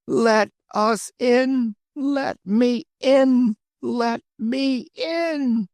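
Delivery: slow, high, drawn-out wails; crying out. Acted. Crowd at the gate crying out to be let in, high wail. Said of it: "they all cried again and again".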